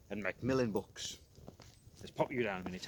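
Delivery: usual speaking voice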